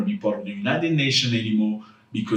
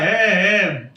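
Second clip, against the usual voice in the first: loud, higher-pitched pretend wail; mock child crying; 7 semitones higher, 5 dB louder than usual. Kid crying after being punished, mock wail. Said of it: "The kid will cry".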